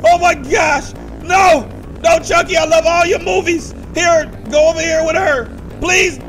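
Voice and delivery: loud, high panicked begging; frightened screams and pleading. Real, not acted. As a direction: scream and beg for mercy.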